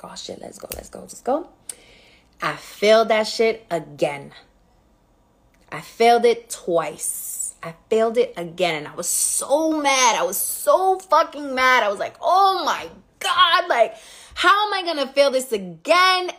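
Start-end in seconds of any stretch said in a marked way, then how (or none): 0.0-1.5 hushed excitement. Retold or imitated. Retold excitement after test. 12.2-16.3 dismayed disbelief. Retold or imitated. Retold dismay at failing again.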